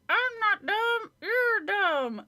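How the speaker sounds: high, slow, squeaky puppet voice; sassy dummy answering back. Acted. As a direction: squeak it like a ventriloquist's doll. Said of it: "in a high, squeaky voice".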